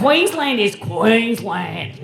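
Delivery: loud, slow Queensland drawl; broad Queensland accent. Shown as a demonstration. Comic showing the guest real Queensland, broad accent. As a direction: draw out QUEENSLAND in broad Australian. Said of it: "can't believe you're from Queensland".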